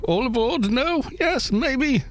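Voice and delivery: high, sing-song coaxing of viewers; rallying call. Real, not acted. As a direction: streamer coaxes chat to join the raid, high playful call.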